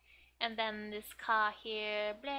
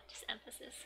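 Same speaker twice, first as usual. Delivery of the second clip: usual speaking voice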